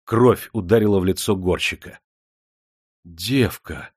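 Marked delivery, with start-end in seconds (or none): 3.0-3.9 ахнув. A narrator's reading. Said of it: "ахнул рудокоп".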